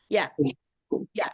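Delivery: ordinary talk, nothing marked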